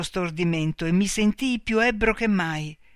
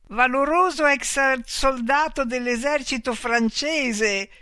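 high, slow, giddy cry; wild elation; 6 semitones higher, slower than usual. A narrator's reading. Tipsy gambler hails the old soldier with giddy high cries. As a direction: call out drunk on winning. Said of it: "gridai in uno stato di furiosa ilarità".

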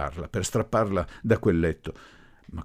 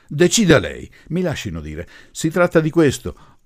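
loud, higher, emphatic address; forceful appeal; 10 semitones higher, 9 dB louder than usual. A narrator's reading. Professor overriding relatives to address the groom, forceful. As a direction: take the floor urgently.

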